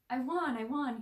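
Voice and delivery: loud, high victory shout; excited yell. A narrator's reading. Mikey yelling he won to his friends. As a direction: shout it out like you just won a race. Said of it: "he yelled".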